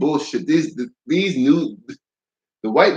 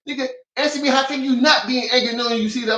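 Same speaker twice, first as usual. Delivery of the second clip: high-pitched, exasperated demand; flipping out; 11 semitones higher, faster than usual. Retold or imitated. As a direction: press him angrily for an answer. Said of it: "I was flipping it".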